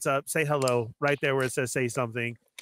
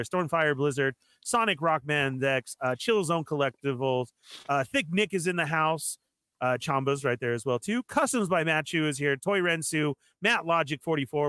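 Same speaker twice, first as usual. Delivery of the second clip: usual speaking voice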